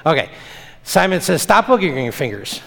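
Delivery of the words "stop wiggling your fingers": normal talking voice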